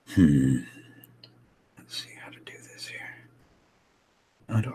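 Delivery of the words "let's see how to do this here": whispered to self; whispering. Real, not acted. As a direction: whisper it under your breath. Muttering to self while drawing.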